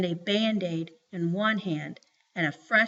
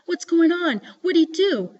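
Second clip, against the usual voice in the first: put-on high, louder worried child voice; jumpy; 8 semitones higher, 5 dB louder than usual. A narrator's reading. Joey asks her brother about angry Mama, jumpy. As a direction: voice a nervous little sister, pitched up. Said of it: "starting to get jumpy".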